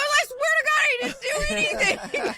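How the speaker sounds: imitated high-pitched denial through laughter; protesting innocence. Husband on one knee denying guilt, mock-panicked.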